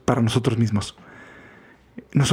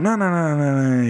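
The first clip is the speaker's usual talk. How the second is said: rechazo imitado de un cumplido. Retold or imitated. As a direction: brush off a compliment, mimicked. Imita rechazo nervioso del cumplido. (rechazo apurado, imitado)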